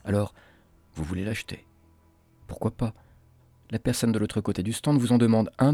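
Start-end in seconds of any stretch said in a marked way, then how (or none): none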